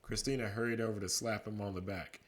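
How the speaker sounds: ordinary delivery, nothing marked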